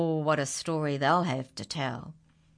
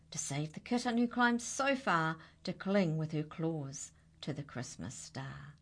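ordinary delivery

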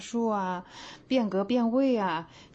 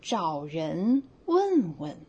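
slow demo read; much slower than usual. Shown as a demonstration. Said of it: "比如说".

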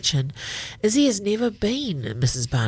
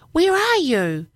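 high, louder call through the house; 11 semitones higher, 4 dB louder than usual. Acted. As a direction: call out searching for someone. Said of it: "Izzy calls".

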